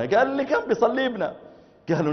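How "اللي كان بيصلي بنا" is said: much higher disbelieving Egyptian; stunned Egyptian repeating; high Egyptian disbelief; incredulous Egyptian. Retold or imitated. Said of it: "أخ مصري".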